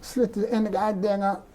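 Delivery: normal talking voice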